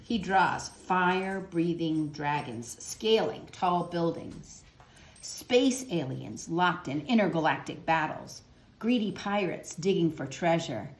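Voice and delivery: put-on gentle storybook narration for kids; reading to children. A narrator's reading. Teacher reading a picture book to children, gentle storytelling. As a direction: read the picture book aloud to young listeners.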